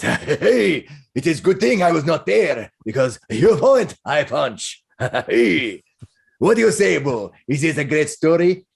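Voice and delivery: jolly brawler delighted by a tale; boisterous hamster owner. Acted. Balding man reacts to the tale with his hamster, boisterous. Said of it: "and he, he says".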